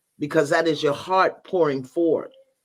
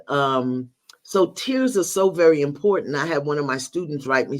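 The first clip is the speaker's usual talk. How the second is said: usual speaking voice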